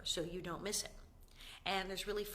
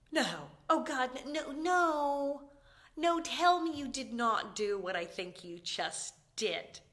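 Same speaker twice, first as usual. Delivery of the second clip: loud, appalled protest; louder, slowed disbelieving no; horrified; 4 semitones higher, 7 dB louder, slower than usual. Retold or imitated. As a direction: beg her not to have done it, aghast. Horrified reaction to friend peeing.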